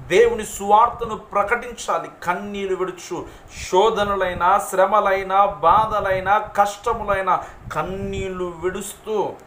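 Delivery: loud, punched list in a preacher's voice; impassioned preaching. Real, not acted. Preacher urging the gospel through every trial, impassioned preaching. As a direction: hammer each hardship home to the congregation.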